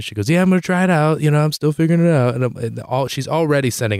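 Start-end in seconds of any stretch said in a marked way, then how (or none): 0.3-2.3 higher, eager retold chatter; excited mom, lightly mimicked; imitating excited mom. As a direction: play a mom thrilled with her new toy. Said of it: "She was all excited".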